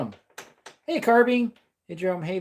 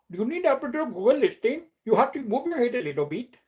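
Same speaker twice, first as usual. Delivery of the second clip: imitating an Indian accent, pitched higher; a joking Indian accent, voice raised; 6 semitones higher than usual. Streamer mimics a guy from India, mock Indian accent. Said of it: "talking like a guy from India".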